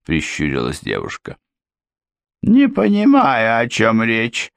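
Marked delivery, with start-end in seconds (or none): 2.4-4.5 high, slow, stubborn housekeeper; stubborn denial. A narrator's reading. Housekeeper stubbornly denying to the girl. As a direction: dig in and deny, slowly. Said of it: "упрямо стояла на своём".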